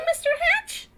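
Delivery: high, loud astonished question; shocked. Acted. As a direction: gasp out his name in disbelief. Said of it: "Mister Smith was shocked".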